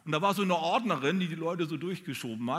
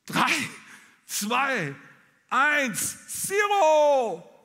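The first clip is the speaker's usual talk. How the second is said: rising from louder and higher to a near shout; building countdown; 10 semitones higher, 8 dB louder, much slower than usual. Retold or imitated. The preacher acts out the last seconds before the jump, building to a loud, high call. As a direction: climb with each number to a loud, high peak.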